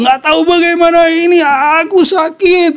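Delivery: high-pitched mock child's wail; imitated crying prayer. Father imitating his injured son praying alone, high tearful pleading. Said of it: "dia nangis, terus dia berdoa".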